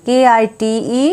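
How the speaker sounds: ordinary talk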